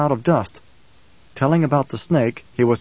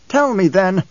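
louder, higher, impatient; an angry prince demanding an answer; 7 semitones higher, 4 dB louder than usual. Acted. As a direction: snap the order at him. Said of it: "The anger was obvious in his tone".

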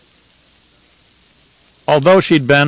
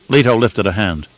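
plain reading voice

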